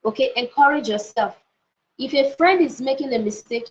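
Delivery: ordinary delivery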